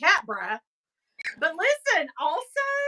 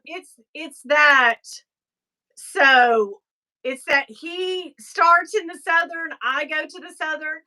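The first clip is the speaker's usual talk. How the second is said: ordinary delivery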